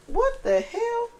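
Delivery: sudden high squeak of surprise, quiet; startled what the hell. Real, not acted. Reader startled by a card flying out, high squeak. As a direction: caught off guard by a card jumping out.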